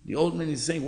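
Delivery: ordinary talk, nothing marked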